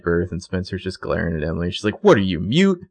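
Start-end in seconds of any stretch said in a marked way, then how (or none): 2.0-2.8 "What are you, mute?" tossed off sharp and raised; mock-snide jab at a friend; snippy retort. Spencer snapping at Emily.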